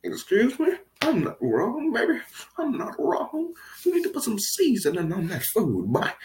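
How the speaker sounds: high, sassy retort; sassy comeback. Retold or imitated. Afro guy sassing Steve at the meeting, high and sassy. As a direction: fire back in a squeaky sassy voice. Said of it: "the guy was like".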